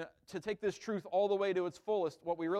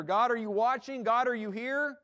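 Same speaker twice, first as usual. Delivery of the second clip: anxious plea; 6 semitones higher, 6 dB louder than usual. Acted. Anxious prayer voiced.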